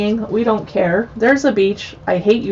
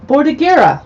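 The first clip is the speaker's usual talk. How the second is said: high, loud Italian-accented place name; 6 semitones higher, 5 dB louder than usual. Shown as a demonstration. Host reading chapter names, showy Italian accent. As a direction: put on an Italian accent, pitched up. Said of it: "my Italian accent".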